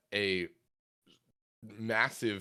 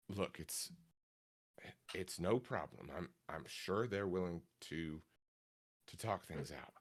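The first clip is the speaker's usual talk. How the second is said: soft reassurance; 2 semitones lower, 9 dB quieter than usual. Acted. GM voices Salvatore reassuring.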